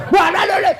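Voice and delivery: high, sudden mock scolding; angry husband, acted. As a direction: cut in as a cross husband. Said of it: "Marah suami tak". (pura-pura suami membentak)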